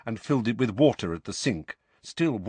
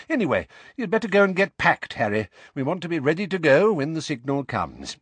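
higher, brisk, businesslike wizard; brisk organiser; 7 semitones higher, 4 dB louder than usual. Acted. Wizard sending Harry off to pack, brisk. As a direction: hurry them along, starting loud.

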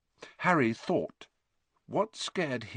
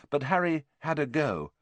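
plain reading voice

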